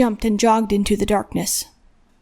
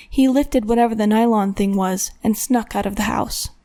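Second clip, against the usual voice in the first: usual speaking voice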